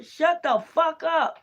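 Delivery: high, slow, menacing growl of the deity; hostile spirit voice. Retold or imitated. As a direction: voice the angry spirit stretching each word. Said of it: "your prayers up there".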